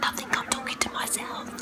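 hushed, voiceless whisper; secretive whisper to the camera. Real, not acted. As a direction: whisper so as not to be overheard. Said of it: "I've got to whisper".

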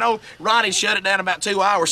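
ordinary talk, nothing marked

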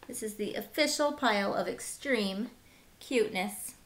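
ordinary delivery

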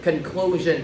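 "Conclusion" with the stress on -clu-; stress on second syllable. Shown as a demonstration. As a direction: lean on the middle syllable of "Conclusion". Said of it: "Âm tiết thứ".

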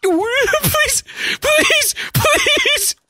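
high pleading cry; mock pleading. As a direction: beg for help high. Pleading for help.

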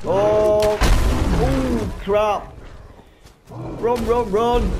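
panicked yelps while fleeing; high, loud, scared shouting; terrified horror victim. Real, not acted. Fleeing the angry beast.

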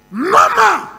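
high, loud exultant cry; preacher crowning his testimony with a loud triumphant cry; triumphant shout. Real, not acted. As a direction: proclaim it in triumph. Triumphant climax of the story.

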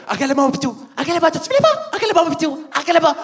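high-pitched coaxing pet talk; dog owner. Acted. Owner pointing something out to the dog, high coaxing. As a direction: urge the doggy to look, hammed up.

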